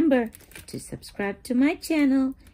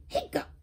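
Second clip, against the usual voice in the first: hiccup; 10 semitones higher, 6 dB quieter than usual. Acted. Kitty hiccups in story.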